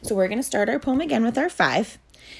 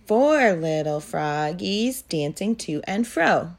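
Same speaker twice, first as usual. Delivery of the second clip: rhythmic sing-song poem reading; poem recitation; much slower than usual. Acted. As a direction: recite it evenly for little kids. Teacher models phrases to echo.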